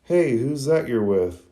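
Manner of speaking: mock call-out at moderate volume. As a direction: hail a friend, lightly acted. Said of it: "one of the boys calls out".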